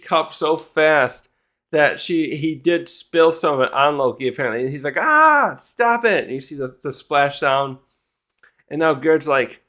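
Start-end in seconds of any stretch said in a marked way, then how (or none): 5.0-6.2 very high mock scream of pain; hammed-up yelp and protest; pained yell. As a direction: act out being scalded, pitch shooting up. Voices Loki splashed with hot soup.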